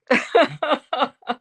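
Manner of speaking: a short bright burst of high laughter. Real, not acted. Laughing at her own everybody answer.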